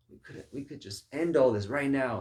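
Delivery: loud, high burst of exasperated urging; urgent pleading. Acted. David's men pressing him to end it, urgent. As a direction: push it out loud and high on the key words.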